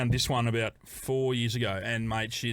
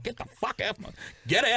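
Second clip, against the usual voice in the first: yelling; 8 semitones higher than usual. Retold or imitated. Monk yelling him out.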